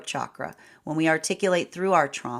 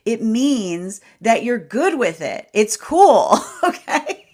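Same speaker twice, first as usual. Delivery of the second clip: giggling through the words, pitched higher; bubbling with laughter, louder; laughing; 6 semitones higher, 10 dB louder, slower than usual. Real, not acted. Reader laughs to viewers that it's okay, giggling. Giggly aside about not being everyone's vibe.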